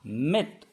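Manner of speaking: teacher presents मित to the class; model word मित. Shown as a demonstration. Teacher giving the reading of मित to students, clear model. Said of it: "तो इसे पढ़ेंगे".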